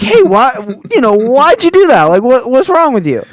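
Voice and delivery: high-pitched mock freak-out with amusement; freaking-out roommate. Roommate scolding the friend who kicked the door, mock freak-out. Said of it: "like, freaking out".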